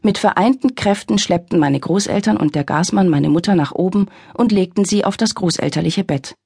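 ordinary delivery

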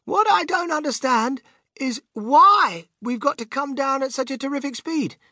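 put-on high-pitched puzzled boy; yelling over noise. A narrator's reading. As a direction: strain to be heard over the din. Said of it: "he yelled above the noise".

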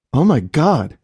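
startled by the horror on screen; shocked wife; frightened gasp. A narrator's reading. Leah sees attack on news.

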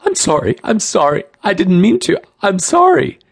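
very high, panicked apology; shrill, shaky. A narrator's reading. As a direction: plead shrilly, voice shaking. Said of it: "came the shrill, shaky response".

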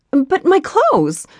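high slow dismayed wail; dismayed cry. A narrator's reading. Heroine protests loss of clothes.

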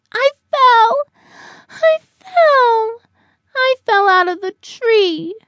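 put-on high, loud childlike wail, easing at the end; crying out. A narrator's reading. Buddy in the grass after his fall, wailing. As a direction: wail it like an upset little one. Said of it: "Buddy cried".

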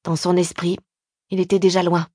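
ordinary delivery, nothing marked

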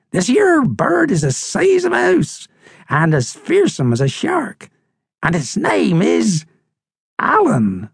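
high bird voice, building suspense; old crusty seabird, dramatic. Acted. Seabird builds suspense about the giant bird, high and dramatic. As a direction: build up to the monster's name.

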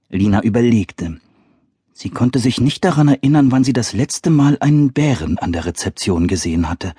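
ordinary delivery, nothing marked

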